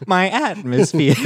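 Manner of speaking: mock Martian. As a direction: tease the odd accent, laughing. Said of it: "You sound like a Martian".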